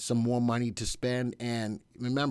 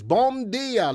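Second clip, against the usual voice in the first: bom dia sung out high and loud; hearty foreign greeting; 9 semitones higher, 6 dB louder than usual. Real, not acted. Host calling out a high, loud bom dia to a viewer.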